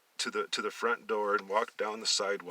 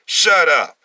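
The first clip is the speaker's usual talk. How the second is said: loud, high angry shut up; angry snap; 8 semitones higher, 16 dB louder than usual. Acted. Leonard snapping at his brother to shut up. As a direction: cut him off hard. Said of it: "was scolding him".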